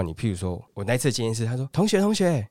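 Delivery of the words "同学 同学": high, sing-song call to a student; hawking saleswoman. Retold or imitated. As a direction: hail a passerby at a mall stall. (高声吆喝的推销大姐)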